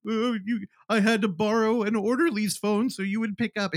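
high, pathetic pleading, lightly imitated; mock crying. Injured husband phones his wife from hospital, mock crying. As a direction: mimic a pathetic man pleading tearfully. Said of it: "he's crying".

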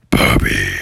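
very low monster voice; cave voice. Acted. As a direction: rumble the name deep and spooky. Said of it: "And he said".

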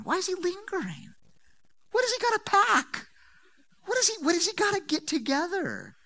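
high, disbelieving questions; incredulous. Acted. Preacher questions Lot's delay, incredulous. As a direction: ask in disbelief.

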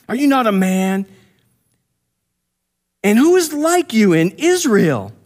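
high-pitched taunting call; mock yell at an enemy camp; retold yelling out. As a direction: call the challenge out high across a distance. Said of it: "This is what he's yelling out".

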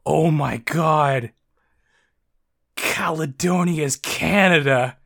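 slow, drawn-out reenacted shock; replayed astonishment, words dragged out; stunned realization. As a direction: let each phrase stretch out as it dawns on you. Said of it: "a double realization".

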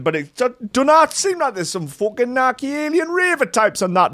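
high-pitched rough British chav voice, hammed up; mock British chav caller bragging about footage; British chav accent. Host reading a YouTuber's post in mock British chav accent. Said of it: "I didn't do the accent".

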